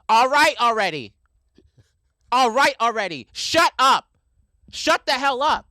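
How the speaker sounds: loud, high exasperated shout; imitated angry yelling. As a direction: yell it as the fed-up passenger. Woman yelling at screaming man on train.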